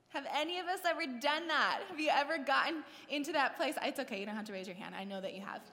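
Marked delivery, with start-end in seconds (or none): none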